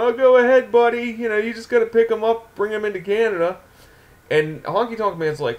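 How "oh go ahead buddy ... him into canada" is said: breezy, higher-pitched promoter brush-off; sleazy wrestling promoter. Acted. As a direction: play a fast-talking promoter smoothing it over. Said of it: "fast-talking wrestling promoter sleaze bag".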